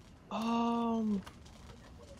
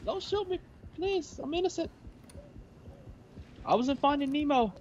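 high-pitched pretend begging; mock pleading; 9 semitones higher than usual. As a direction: beg for your life, hammed up. Pleading in character.

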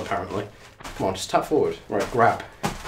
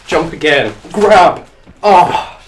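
loud, raised game commands; calling out moves; 5 semitones higher, 10 dB louder, much slower than usual. Real, not acted. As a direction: shout each move as you make it.

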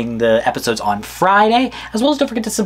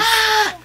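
a sharp, high excited gasp. Real, not acted. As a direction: gasp with delight.

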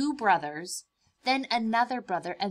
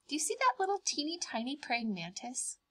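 ordinary delivery, nothing marked

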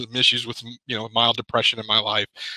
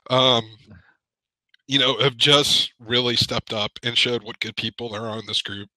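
ordinary talk, nothing marked

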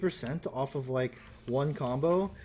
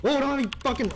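panicked; 10 semitones higher, 5 dB louder, much faster than usual. Real, not acted. Panic in fight.

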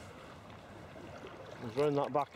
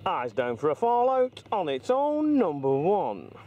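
slow, drawn-out bingo call, higher and louder; bingo caller; 7 semitones higher, 4 dB louder, slower than usual. Pretend. Bingo number call while boating.

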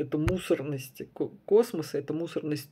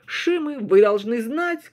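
shouting to the crowd in the square; rallying cry from a soapbox; loud soapbox ranting; 6 semitones higher, 8 dB louder, slower than usual. A narrator's reading. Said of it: "кричал на площади Фрейд". (громкий призыв оратора на площади)

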